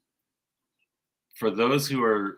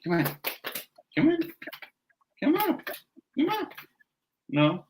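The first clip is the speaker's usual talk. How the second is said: much higher sing-song pet talk; coaxing the cat; 11 semitones higher than usual. Real, not acted. Guest coaxing her cat to come up to her, in high pet talk. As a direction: sweet-talk the cat into jumping up.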